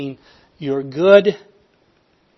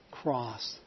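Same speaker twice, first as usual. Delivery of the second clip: normal talking voice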